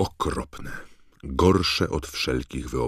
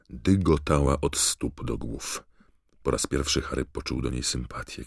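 plain reading voice

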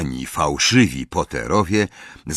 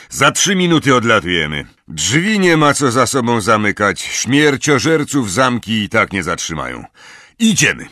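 raised, louder commanding voice; lightly voiced brisk commander; stern leader giving orders; 5 semitones higher, 5 dB louder than usual. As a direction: order the team to move out. Moody gives departure orders.

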